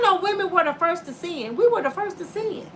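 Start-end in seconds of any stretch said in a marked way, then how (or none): none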